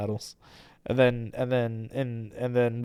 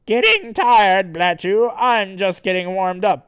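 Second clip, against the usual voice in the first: loud, raised cocky hero; Sonichu; 7 semitones higher, 8 dB louder than usual. Acted. Sonichu taunts his tiring rival, loud and cocky. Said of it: "Sonichu says".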